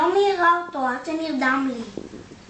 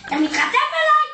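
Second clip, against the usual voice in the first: outraged, pitched much higher; shocked that the kid talks back; indignant; 10 semitones higher than usual. Acted. Mother scolding the cheeky kid at her door, indignant and high.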